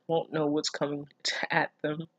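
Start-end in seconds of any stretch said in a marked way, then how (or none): none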